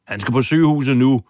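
loud, high and fast call for help; urgent shout. Retold or imitated. Officer shouting for his injured colleague, urgent loud. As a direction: bark it out to the other officers. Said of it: "råbte en anden betjent".

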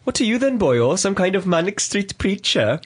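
put-on high, playful Welsh accent; sing-song Welsh bloke. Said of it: "bust out my Welsh accent".

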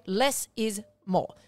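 drawn-out key advice; slow emphasis. Shown as a demonstration. Coach slowly stressing the main rule to viewers. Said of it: "slow emphasized advice".

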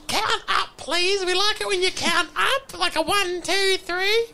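put-on very high little-kid voice; pleading children. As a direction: beg sweetly as a small child. Playing children pleading with Dad.

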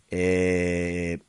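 normal talking voice

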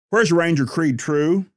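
lightly voiced captain calling out. A narrator's reading. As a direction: call across the office to the secretary.

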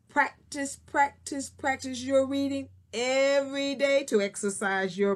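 "practice" three times, each stressed; repeated emphasis. Shown as a demonstration. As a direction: hammer "practice, practice, practice". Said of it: "repeats practice for emphasis".

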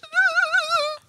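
high mock wail of a crying kid; imitated child's cry. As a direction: wail like a little boy bursting into tears. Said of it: "starts, like, crying in the back seat".

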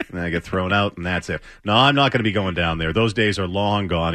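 normal talking voice

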